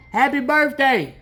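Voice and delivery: loud, high friendly call. Real, not acted. Preacher shouts birthday wishes to a friend outside, loud and warm. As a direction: shout happy birthday across the yard. Calls across yard.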